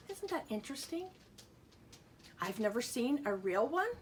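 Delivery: ordinary talk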